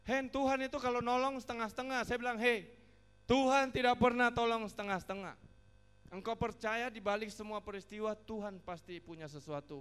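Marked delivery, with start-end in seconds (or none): 0.0-2.0 loud, high-pitched retold complaint; angry grumbling. Friend complains to the preacher that God half-helps, angry grumbling. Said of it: "Dia begitu marah, dia bersungut-sungut". 2.4-9.8 loud, high-pitched correction; forceful rebuke. Retold or imitated. Preacher answers his doubting friend, forceful rebuke.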